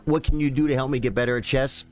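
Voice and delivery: ordinary delivery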